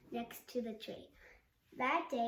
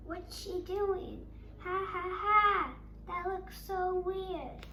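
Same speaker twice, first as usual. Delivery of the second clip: slow, higher, teasing jeer with loud ha ha; mocking; 7 semitones higher, 6 dB louder, slower than usual. Ten children laughing at Anna, mocking jeer. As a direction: sneer at her like a schoolyard bully.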